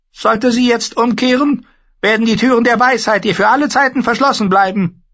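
very high, loud, eerie warlock voice; a thin, uncanny sorcerer. Acted. The warlock Pyat Pree bars the way, high and eerie.

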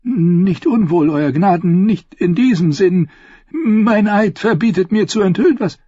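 hint of a hesitant old scholar; old maester. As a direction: tread around the secret. Maester evades slowly.

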